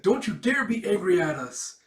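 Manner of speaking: high, loud, defiant cry; fierce protective shout. Retold or imitated. Big sister yelling at the mother to protect Perry, fierce shout. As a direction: shout it to defend your little sister. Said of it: "protecting her like a big sister".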